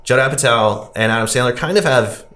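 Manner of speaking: usual speaking voice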